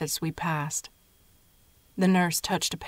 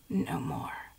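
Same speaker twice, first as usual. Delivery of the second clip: faint, softer whisper; weak whisper; 7 dB quieter than usual. A narrator's reading. Sick grandmother declining more water, faint whisper. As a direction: barely push the words out. Frail patient refusing water.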